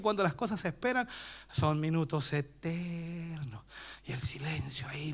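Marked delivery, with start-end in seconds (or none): 4.1-5.1 susurrando el silencio; susurro. A narrator's reading. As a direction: baja la voz a un susurro. Silencio expectante en la tumba. (narración susurrada del silencio)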